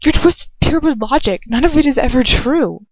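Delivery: heated retort. A narrator's reading. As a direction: throw his logic back at him.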